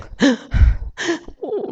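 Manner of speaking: high gasping mock sobs; isak tangis tiruan. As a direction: imitate sobbing with sharp, gasped breaths. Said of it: "Nangis yang". (menirukan isak tangis tersengal)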